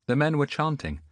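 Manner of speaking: ordinary delivery, nothing marked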